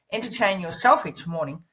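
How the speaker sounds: normal talking voice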